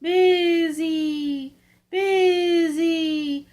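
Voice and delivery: the wind's voice, high and loud; eerie moaning wind chant; howling wind. A narrator's reading. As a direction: howl it like a storm wind through the chimney. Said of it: "And the wind blew".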